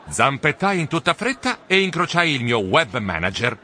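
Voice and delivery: ordinary delivery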